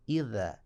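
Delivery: इज़ा with the soft th of Arabic idha; tongue between the teeth for इज़ा; इज़ा said as idha, not iza; soft th sound. Shown as a demonstration. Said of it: "बल्कि".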